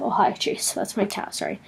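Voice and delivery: hushed whisper to the cat; whispered aside. Real, not acted. Greeting her cat mid-video, whispered aside. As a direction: drop to a whisper for a quick aside.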